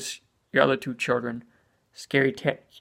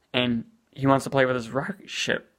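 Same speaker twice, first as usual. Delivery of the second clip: ordinary talk, nothing marked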